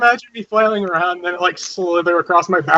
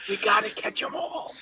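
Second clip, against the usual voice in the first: whisper; 13 dB quieter than usual. Acted. Playful quote.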